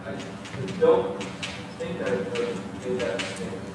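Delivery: timid near-whisper, trailing off; barely audible. Acted. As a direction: mumble it so he can't hear. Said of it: "would you speak up, please? I couldn't hear you".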